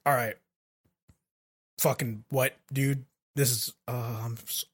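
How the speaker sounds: soft, low disbelieving; stunned quiet voice. Retold or imitated. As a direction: barely get the words out. Voicing hero stunned.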